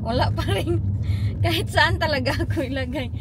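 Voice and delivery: loud near shouting through laughter; mother exclaiming loudly at her own look; loud laughing talk. Real, not acted. Loud laughing over self.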